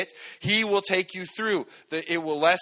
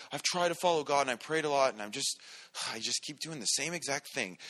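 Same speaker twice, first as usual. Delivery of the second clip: defeated sigh; 3 semitones lower, 9 dB quieter than usual. Retold or imitated. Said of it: "You're like".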